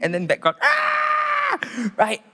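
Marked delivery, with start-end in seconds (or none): 0.6-1.6 very high acted scream; possessed girl shrieking behind the prayer; mock shriek. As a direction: shriek from the background.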